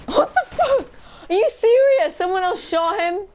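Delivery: high, loud outburst; outraged disbelief. Real, not acted. Player whose target someone else shot, outraged high shouting.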